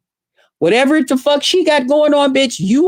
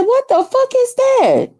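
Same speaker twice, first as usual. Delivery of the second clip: startled shriek; 13 semitones higher than usual. Retold or imitated. Startled reaction in the retold scene.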